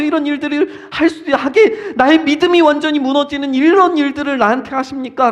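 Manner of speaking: anguished protest. Acted. Believer complaining to God about trials, anguished protest. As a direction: pile up the questions in acted complaint.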